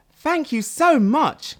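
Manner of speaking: high, slow, breathless little snail voice; gasping tiny snail. Acted. Rescued snail gasps thanks to Sad, high and breathless. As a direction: gasp it out as a tiny grateful creature. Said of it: "gasped the tiny snail".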